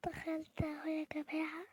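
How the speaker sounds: hushed, unhurried aside; slow whisper. Real, not acted. Vlogger entering again, slow whisper. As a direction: whisper it slowly as you go in.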